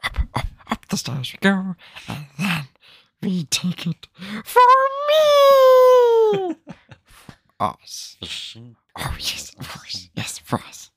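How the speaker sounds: sly creature voice, shriek then hushed whisper; Gollum. Acted. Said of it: "that's the quote I can do, my best Gollum voice".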